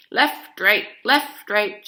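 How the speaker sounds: loud marching call left right; Grandma marching behind the guards; hollered march. A narrator's reading. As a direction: bark it like a drill sergeant. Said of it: "she hollered".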